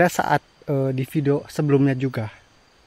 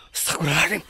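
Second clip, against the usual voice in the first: frightened cry; 4 semitones higher, much faster than usual. Real, not acted. Crying out in fear.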